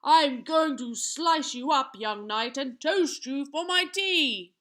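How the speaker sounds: loud giant roar, last words stretched; gleeful bellow. A narrator's reading. Giant gallops at the young knight, bellowing gleefully. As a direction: bellow it with wicked glee. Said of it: "bellowed out with glee".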